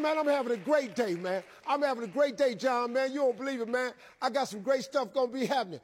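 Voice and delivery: exuberant, pumped-up phone talk; gushing enthusiasm. Retold or imitated. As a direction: bubble over to prove the new attitude. Boasts of great day to caller.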